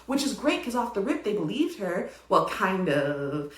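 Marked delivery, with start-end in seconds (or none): none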